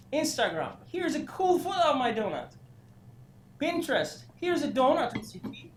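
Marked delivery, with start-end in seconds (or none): 0.9-2.5 mock cheerful post. Voicing each platform's donut post. 4.4-5.7 mock cheerful post. Voicing each platform's donut post.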